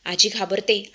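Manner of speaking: ordinary delivery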